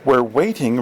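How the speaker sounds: plain reading voice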